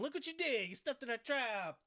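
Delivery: high, crowing accusation; mock gotcha. Host playing the trapper catching Paige, mock gotcha. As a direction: pounce on the mistake, hammed up. Acting out the trap springing.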